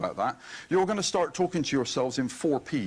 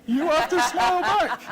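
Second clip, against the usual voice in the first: much higher, louder, laughing; high laughing squeal; 14 semitones higher, 6 dB louder than usual. Real, not acted. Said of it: "stalkery type smile".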